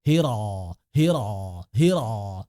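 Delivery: hiro with a guttural throat r; throaty ro. Shown as a demonstration. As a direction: scrape hiro's r in the throat. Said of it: "yang saya dengar itu hiro". (ro tenggorokan)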